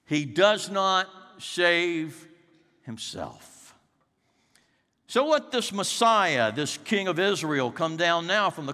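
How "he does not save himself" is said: slow, then himself falls away to a hush; solemn slow contrast, fading at the end; slow emphatic. Shown as a demonstration. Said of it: "Listen to that phrase".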